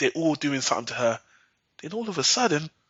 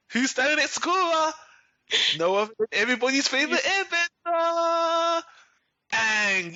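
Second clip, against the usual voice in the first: hyped reveal; 10 semitones higher than usual. Acted. Hypes Aang's reveal.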